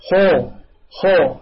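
خو as a full, dark rounded "khaw"; heavy demo syllable خو; correct heavy kha. Shown as a demonstration. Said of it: "هكذا".

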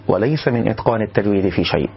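ordinary talk

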